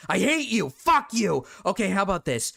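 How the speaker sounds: high, loud playful mock fury; mock angry. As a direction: fake rage at a friend. Playful mock rage at cohost.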